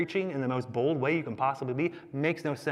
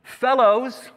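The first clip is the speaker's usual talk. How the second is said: high, loud, drawn-out hail; calling out; 9 semitones higher, 6 dB louder than usual. Acted. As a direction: call out across the water. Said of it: "He called out".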